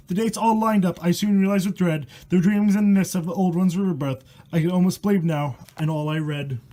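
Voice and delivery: steady rhythmic verse read aloud; poem reading. A narrator's reading. Reading a rhyming horror poem to viewers, rhythmic storytelling.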